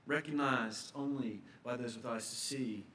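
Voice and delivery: normal talking voice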